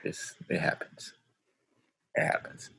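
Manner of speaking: very soft, whispered aside; hushed aside. Real, not acted. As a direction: drop to a near-whisper. Muttered resigned aside after joke.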